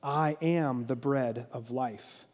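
slow, measured, low declaration; solemn declaration. A narrator's reading. Reading Jesus' words to the congregation, solemn declaration. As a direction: declare it slowly and softly. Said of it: "then Jesus declared".

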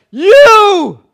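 very high, very loud outraged yell; preacher imagining himself as Jesus, screaming at the betrayer; furious shout. Pretend. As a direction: throw him out in a rage. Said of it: "I would have said".

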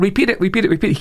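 high, urgent preacher's push; imitated exhortation. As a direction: drive the crowd on. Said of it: "Keep repeating it".

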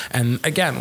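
usual speaking voice

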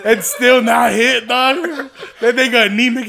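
loud laughing jab; laughing shout. Real, not acted. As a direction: yell it while laughing hard. Laughing, mocking friend loudly.

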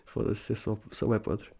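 whispered laughing self-mock; soft whispered aside. Real, not acted. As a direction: mutter it under your breath, amused. Embarrassed aside.

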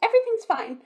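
high, loud mock reassurance; forced positivity. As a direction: parody someone insisting all is well. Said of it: "you're not constantly being like".

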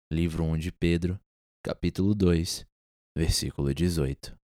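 slow, solemn Bible reading; scripture reading. Acted. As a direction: read it like a sermon verse.

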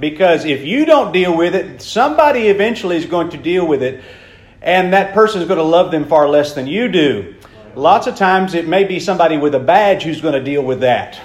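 normal talking voice

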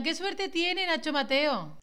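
mock envious gossip. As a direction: drag it out like jealous gossip. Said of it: "Pa' que luego digan".

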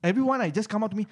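usual speaking voice

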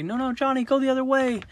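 high-pitched call to a small player; calling out to Johnny. Retold or imitated. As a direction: sing out a correction to a little kid. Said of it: "and we say".

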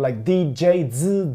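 ordinary delivery

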